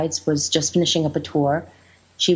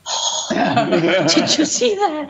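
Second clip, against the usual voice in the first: high gasp, then laughing disbelief; hammed-up amazed reaction; mock calm turned astonished; 7 semitones higher, much slower than usual. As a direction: gasp and squeal it through laughter. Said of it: "looked at each other very calmly and went".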